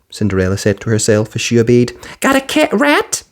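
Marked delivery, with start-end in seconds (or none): 2.2-3.2 high brisk fairy voice; quick and businesslike; fiery fairy. A narrator's reading. Fiery fairy asking Cinderella for mice, brisk. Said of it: "asked the fairy".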